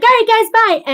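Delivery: high, loud, chirped goodbye; mock abrupt sign-off. Imagining abruptly ending the stream, high loud mock goodbye. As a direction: rattle off a shrieky quick farewell. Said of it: "I don't wanna just be like".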